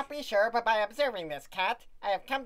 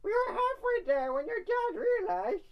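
mom voice; 12 semitones higher than usual. Acted. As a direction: play the mother. Mom voice continues.

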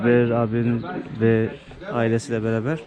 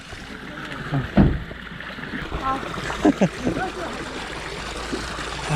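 breathless laugh at icy water; gasp-laugh after a cold sip; 13 semitones higher than usual. Real, not acted. Drinking ice cold water. (buz gibi sudan nefessiz gülüş)

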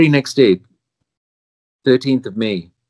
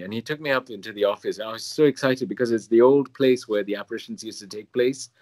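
ordinary talk, nothing marked

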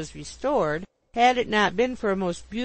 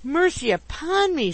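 high, loud cry for mercy; struggling alarm; 9 semitones higher, 5 dB louder than usual. A narrator's reading. Pickwick struggling in his landlady's embrace, alarmed. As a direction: exclaim in panic, fighting free. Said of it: "struggling violently".

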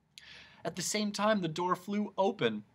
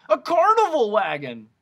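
high, very loud cry of discovery; excited exclamation; 7 semitones higher, 11 dB louder than usual. A narrator's reading. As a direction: call it out big and high. Character excitedly identifies the wagon.

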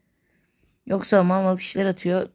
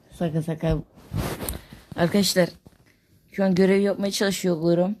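ordinary talk

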